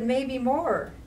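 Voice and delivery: plain reading voice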